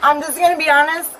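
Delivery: loud, fast, heated warning; loud and agitated. Real, not acted. Angry woman warning officers she's recording them, loud. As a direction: talk over the officer, loud. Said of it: "you're being too loud".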